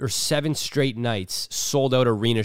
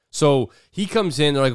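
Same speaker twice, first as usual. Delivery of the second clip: usual speaking voice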